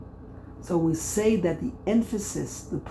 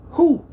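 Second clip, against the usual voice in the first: "Hu" high and loud on its own; isolated syllable; 10 semitones higher, 9 dB louder than usual. Shown as a demonstration. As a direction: punch out "Hu" as the final counted syllable. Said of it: "You break up the word".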